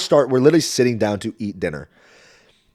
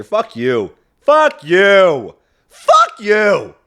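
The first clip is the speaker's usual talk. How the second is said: building from usual level to loud, high yell; mock outburst; 8 semitones higher, 8 dB louder, much slower than usual. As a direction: escalate each curse into a hammed-up shout. Mock outburst at English major, building.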